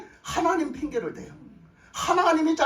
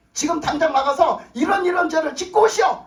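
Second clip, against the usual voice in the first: raised, louder order to go sin; mock command; 5 semitones higher, 5 dB louder than usual. Preacher testing the congregation with a mock order. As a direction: bark a pretend order at the flock. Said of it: "내가 이렇게 말했어".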